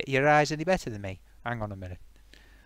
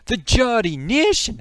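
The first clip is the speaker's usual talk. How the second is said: high, loud, drawn-out "Geordie Nation"; exasperated correction; 8 semitones higher, 6 dB louder than usual. Acted. Geordie insisting on "Geordie Nation", exasperated and loud. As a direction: belt out "Geordie Nation" slowly. Repeats correction more forcefully.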